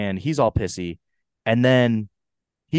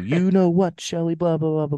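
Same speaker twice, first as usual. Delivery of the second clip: raised, high sing-out voice; imitating an actor's storytelling; 7 semitones higher than usual. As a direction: act out a proud salesman's tale, pitched high. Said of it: "just the way his voice moves".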